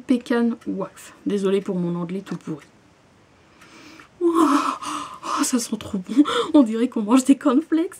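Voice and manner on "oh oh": ordinary talk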